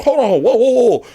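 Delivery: mock-shocked friend pulling his girl up short; startled protest.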